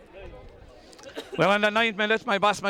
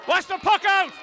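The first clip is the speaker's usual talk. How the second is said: shouting; 9 semitones higher than usual. Real, not acted. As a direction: yell the warning at the team. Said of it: "I'm shouting into the microphone".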